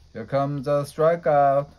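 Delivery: loud teasing call; mocking yell. A narrator's reading. Sluggers heckling Bobby as he steps up, yelling. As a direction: heckle the batter from the field. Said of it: "yelled the Sluggers".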